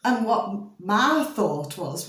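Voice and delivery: ordinary delivery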